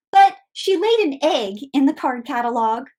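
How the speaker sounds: ordinary talk, nothing marked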